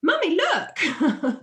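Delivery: put-on high, slow, thrilled child voice showing off; a small boy proudly calling his mum over; happy child who doesn't know he's covered in wasps; delighted little boy. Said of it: "And he's like".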